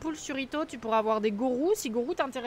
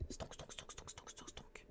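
whispered stonks; 24 dB quieter, slower than usual. Pretend.

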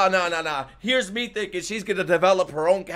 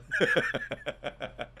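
laugh; 8 dB quieter than usual. Real, not acted. Viewer amused by the anime he watches, laughing. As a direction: chuckle softly to yourself.

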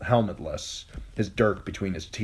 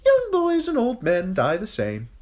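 high thin voice; 6 dB louder than usual. Acted. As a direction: command the gates open, high and thin. Sir Amory answers.

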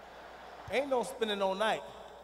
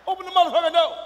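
loud, raised-pitch order through a door; angry shouted demand; 7 semitones higher, 12 dB louder, faster than usual. Retold or imitated. Father at daughter's door, angry shouted demand. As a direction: yell to be let in.

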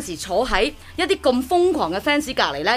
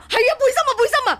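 high, excited mock fans; high-pitched imitation of excited friends; 12 semitones higher, faster than usual. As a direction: squeal it like thrilled friends. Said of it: "跟住我兩個friend".

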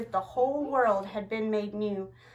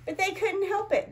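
ordinary delivery

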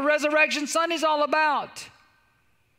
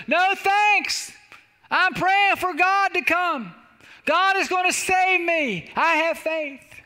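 high, slow holler; stubborn refusal called up to a helicopter; calling back; 7 semitones higher, 4 dB louder, slower than usual. Acted. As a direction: stretch each phrase, acting the stranded man. Stranded man hollers back refusal.

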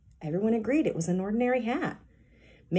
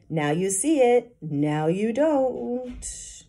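slow, drawn-out puzzled patter; confused; 6 semitones higher, 4 dB louder, much slower than usual. A narrator's reading. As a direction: trail off, losing the thread of the trick. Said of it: "Mitch sounded confused".

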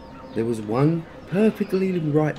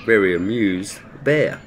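plain reading voice

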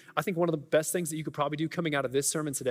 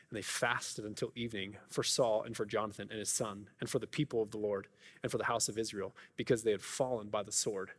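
scripture reading; 5 semitones lower, 4 dB quieter than usual. Acted. Pastor reading David's mourning, soft and low. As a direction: let the mourning verses fall quiet.

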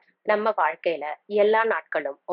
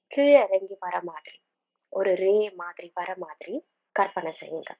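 unhurried meditation guide, long pauses between phrases; calm, spaced-out instructions with room to breathe; guided meditation. Acted. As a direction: lead them gently through breathing and imagery.

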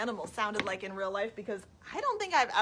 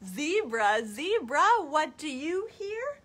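put-on reading to children; 2 semitones higher, 5 dB louder, much slower than usual. Storytime answer about a snake, slow and drawn-out. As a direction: stretch the hiss in HISSING. Reads picture book to children.

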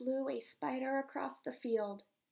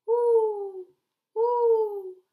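high loud hooting owl; 8 semitones higher, 12 dB louder than usual. Acted. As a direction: hoot like an owl. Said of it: "asked the owl".

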